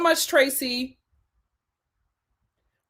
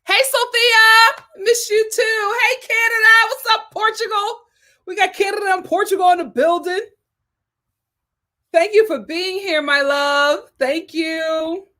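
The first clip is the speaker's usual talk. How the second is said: bright greeting; 8 semitones higher than usual. Real, not acted. Greeting viewers in chat.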